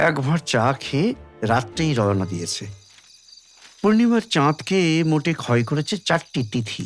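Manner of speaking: ordinary delivery, nothing marked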